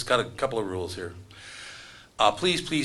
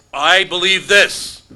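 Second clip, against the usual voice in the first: high, loud, slowed-down declaration; emphatic proclamation; 8 semitones higher, 12 dB louder, much slower than usual. Acted. Comedian mimicking someone with a strong belief, emphatic proclamation. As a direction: proclaim it hammed-up, stretching the words.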